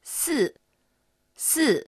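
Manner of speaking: す high, then steeply down, lips spread; falling tone. Shown as a demonstration. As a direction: drop す sharply with an "ee" mouth. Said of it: "いの口で高いところから一気に". (「す」を高い所から一気に下げる)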